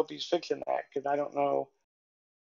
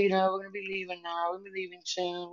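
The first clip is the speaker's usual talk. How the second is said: put-on softer, quicker aside to a child; quiet reassurance; 8 dB quieter, faster than usual. Grandmother bending down to kids in store, quietly informing.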